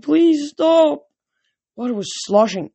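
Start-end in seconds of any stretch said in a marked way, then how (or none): 0.0-1.1 high, pleading horse voice; horse crying out. A narrator's reading. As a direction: cry out loud like a horse begging. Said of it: "a horse cried".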